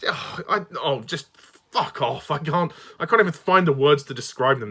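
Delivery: ordinary talk, nothing marked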